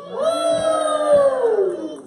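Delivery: high, loud "woo!" cheer; big drawn-out crowd-rousing cheer; excited whoop. Real, not acted. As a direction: let out a long, piercing whoop. Cheering after asking who's excited.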